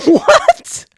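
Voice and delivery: shrieked high, loud disbelieving what; a stunned, shrill yelp of disbelief; shocked what. Real, not acted. Stunned by a clip's dark joke.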